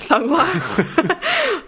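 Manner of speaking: high, laughing echo. Real, not acted. Guest repeats her own odd phrase to the hosts, laughing. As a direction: laugh through the words. Laughing at being quoted back.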